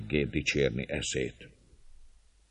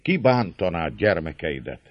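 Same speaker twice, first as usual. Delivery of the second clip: usual speaking voice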